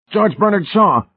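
high, loud, fearful outcry; fearful howl. A narrator's reading. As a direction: howl the name in alarm. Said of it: "McFaith howled fearfully".

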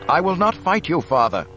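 high, loud, firm refusal; young Luke, resolute. A narrator's reading. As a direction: stand your ground against your father. Luke defies his father.

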